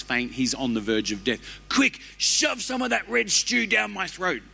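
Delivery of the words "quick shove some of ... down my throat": high, urgent voice of a starving man; starving Esau. Acted. Preacher voicing Esau, desperate and high. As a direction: beg for the stew like you're fainting.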